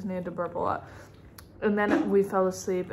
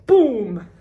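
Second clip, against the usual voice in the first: loud mock boom; 3 semitones higher, 12 dB louder than usual. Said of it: "She goes".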